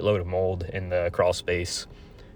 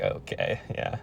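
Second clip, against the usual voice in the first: deadpan resignation; 14 semitones lower, 8 dB quieter than usual. Retold or imitated. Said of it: "I was just like".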